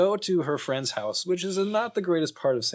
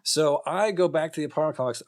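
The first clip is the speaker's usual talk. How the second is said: normal talking voice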